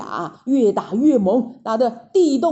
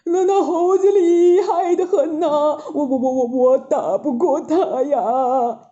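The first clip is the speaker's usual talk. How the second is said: wailing, stuttering report of defeat; whimpering beaten soldier, hammed up; frightened stammer; 4 semitones higher, 4 dB louder than usual. A narrator's reading. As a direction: stammer it out in a quavering panic. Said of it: "结结巴巴地说".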